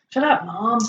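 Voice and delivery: put-on American teen talking back; American accent. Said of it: "You sounded really American then".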